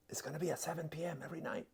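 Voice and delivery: fast breathy whisper of the time; hushed, hurried aside; quick whisper. Retold or imitated. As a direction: rattle it off under the breath. Unvoiced quick aside in quote.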